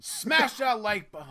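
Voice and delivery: loud, high, drawn-out shout; hype plug. Pretend. Co-host urging viewers to like, loud slow hype shout. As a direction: belt it out slowly like an over-hyped plug.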